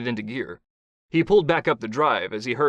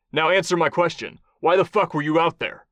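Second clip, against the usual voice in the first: raised, louder demand; angry interrogation; 6 semitones higher, 4 dB louder than usual. Acted. Kai orders the captive to answer, angrily. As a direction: press hard for an answer.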